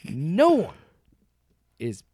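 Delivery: deliberate, broken into small emphatic pieces; clear and simple. Real, not acted. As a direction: spell it out for the really stupid ones. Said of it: "To say it as clear as I can".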